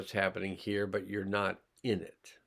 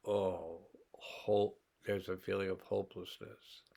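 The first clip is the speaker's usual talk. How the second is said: a hushed, much softer voice of hopelessness; hopeless hush; 2 semitones lower, 6 dB quieter than usual. Acted. Teacher dropping into despair to demonstrate, hushed. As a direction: fade out as if all hope is gone.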